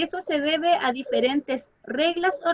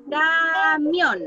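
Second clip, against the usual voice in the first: Camión stretched out, MIÓN leaned on; stress on MIÓN; 3 semitones higher, 6 dB louder than usual. Shown as a demonstration. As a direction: say Camión slowly, marking MIÓN with more voice. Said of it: "yo marco más la voz".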